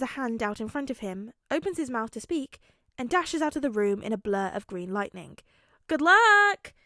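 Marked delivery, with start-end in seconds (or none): 5.9-6.7 loud, high shout after someone running; two friends calling good luck after a running boy. A narrator's reading. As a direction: call after him as he dashes off. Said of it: "call out after him".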